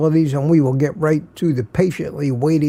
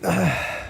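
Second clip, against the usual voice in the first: a long, weary sigh; soft tired exhale; 6 dB quieter than usual. Real, not acted. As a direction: let the breath out, worn out. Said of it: "if I sound a little tired".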